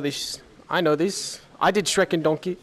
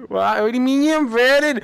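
ordinary delivery

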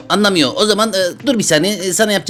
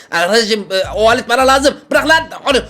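mock scolding; 6 semitones higher than usual. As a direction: scold the toy patient like a fussy doctor. Doctor scolds a toy patient.

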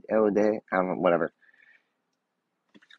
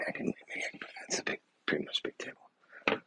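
whisper; 12 dB quieter than usual. Real, not acted. Aside while unpacking.

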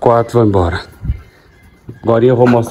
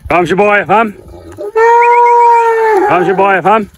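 higher sing-song herding call; calling a cow; 10 semitones higher than usual. Real, not acted. Farmer calling Jiboia out of the pasture. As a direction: coax Jiboia to come along.

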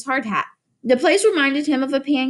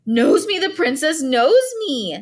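ordinary delivery

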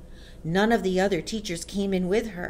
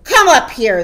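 very loud, raised-pitch command; shouted order; 4 semitones higher, 14 dB louder than usual. A narrator's reading. Headmistress summons a boy to the platform, shouted. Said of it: "the Trunchbull shouted".

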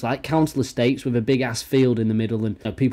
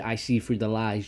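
ordinary delivery

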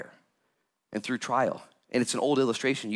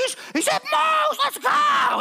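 much higher, loud excited shout; excited crowd; 6 dB louder, slower than usual. Retold or imitated. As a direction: yell it like you just spotted a celebrity. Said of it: "people are like".

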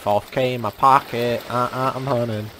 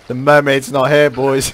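normal talking voice

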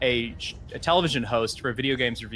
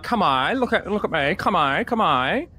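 satirised Australian women's accent; 4 semitones higher than usual. Shown as a demonstration. Imitating a TV comedy for friends, satirised Australian accent. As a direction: exaggerate a satirised Aussie woman's accent, pitched up. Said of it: "this accent that kinda got satired".

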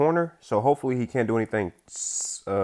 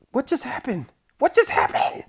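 high-pitched squeal, growing louder; shocked disbelief; 5 dB louder than usual. Real, not acted. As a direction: blurt it out in rising disbelief. Stunned by a bad shot.

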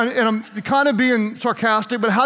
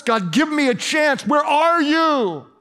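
higher cry, last words drawn out; acted pleading cry; 6 semitones higher, slower than usual. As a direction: call out to an absent God. Acting Paul's impatient cry to God.

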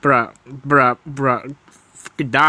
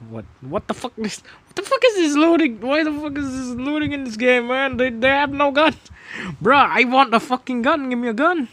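high-pitched complaint, far above usual voice; exasperated rant; 11 semitones higher than usual. Real, not acted. Gamer ranting at the game over no guns, exasperated.

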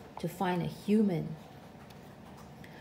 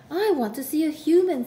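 put-on high, loud, eager kid; bursting with excitement; 7 semitones higher, 8 dB louder than usual. A narrator's reading. Eager gum nut child.

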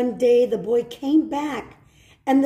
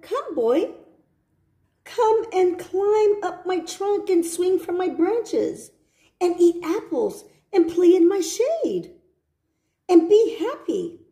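a lightly voiced tree, higher; lightly higher, coaxing tree voice; 8 semitones higher than usual. The tree invites the boy to play.

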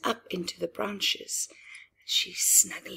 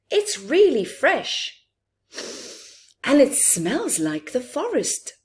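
put-on excited child; 7 semitones higher, 7 dB louder than usual. Child in story asks mother.

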